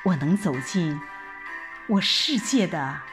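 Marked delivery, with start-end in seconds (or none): none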